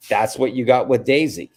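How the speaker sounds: ordinary delivery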